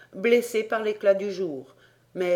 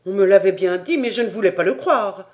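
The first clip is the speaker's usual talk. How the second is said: loud, high indignant rant; 5 semitones higher, 10 dB louder than usual. Acted. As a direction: keep shouting your outrage. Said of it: "s'écria Paganel". (tirade indignée à pleine voix)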